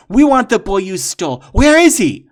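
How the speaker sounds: loud, high angry demand; rising furious shout. Acted. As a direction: demand an answer now. Warrior demands the boy.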